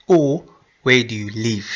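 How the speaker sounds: ordinary talk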